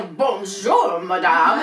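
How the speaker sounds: put-on loud French-accented "Bonjour, madame"; waiter's prompting French bonjour; French greeting.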